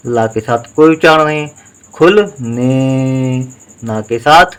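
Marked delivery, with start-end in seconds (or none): 1.9-3.4 very slow, stretched out; drawn out syllables. Shown as a demonstration. Teacher dictating a Hindi word to pupils, drawn out slowly. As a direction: sound it out slowly for the class. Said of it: "teacher sounds out word".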